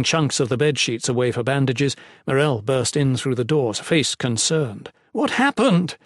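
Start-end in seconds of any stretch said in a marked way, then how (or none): 5.1-6.0 exclaiming. A narrator's reading. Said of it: "she exclaimed".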